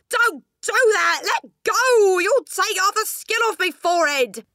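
high, very loud panicked yelling; pained screaming. A narrator's reading. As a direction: yell at her to stop hurting you. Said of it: "let out a yell that rattled the window panes".

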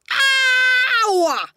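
long, high yell of pain; pained scream. A narrator's reading. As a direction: howl as if your skin is being pulled. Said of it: "he screamed".

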